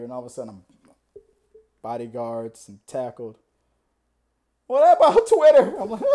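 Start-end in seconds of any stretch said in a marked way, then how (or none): 4.7-5.8 high, loud protest; mock desperate yell. Tackled fan yelling to the star, desperate protest. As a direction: cry it out as if being hauled away.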